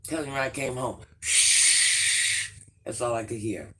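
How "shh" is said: imitated hiss of water rushing. Imitating the pipe water she heard, loud hissing shh. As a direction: make a long loud shh like pouring water.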